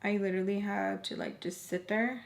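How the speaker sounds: usual speaking voice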